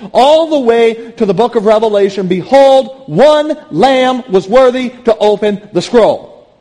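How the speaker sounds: high, loud, impassioned pulpit delivery; ringing, emphatic sermon climax; full-force preaching. Real, not acted. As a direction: proclaim it to the whole church with rising fervour.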